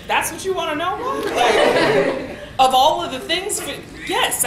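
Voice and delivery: high-pitched, incredulous retort; exasperated disbelief. Retold or imitated. Daughter answering mom's odd question, exasperated. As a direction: protest in exasperation, pitch jumping up.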